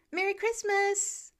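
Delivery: slow, high yell over the shoulder; yelling back. A narrator's reading. Peter leaving the soup kitchen yells to the volunteers. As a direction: shout it back while running out. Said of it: "he yells back".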